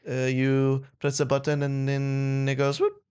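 drawn-out fake French accent; mock French accent. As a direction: explain it as a comic Frenchman. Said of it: "I just like doing that accent".

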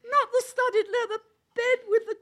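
shrill falsetto, loud, cracking with laughter; high falsetto laughing. Acted. Griffin's mock protest, falsetto and laughing. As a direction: squeal the protests through giggles. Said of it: "in a high falsetto".

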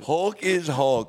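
slow Hulk toy catchphrase; pull string toy. Pretend. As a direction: drag it out like a talking toy. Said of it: "the catchphrases when you pull the string on his toy".

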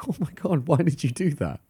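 mock complaint. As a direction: play a mildly hammed-up groggy protest. Acting man slapped awake.

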